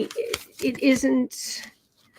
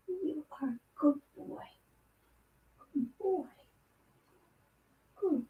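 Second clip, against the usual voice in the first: higher whisper to a pet; whispered praise; 5 semitones higher, 13 dB quieter than usual. Real, not acted. Reader whispering praise to her cat, higher whisper. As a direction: breathe the praise softly to the cat.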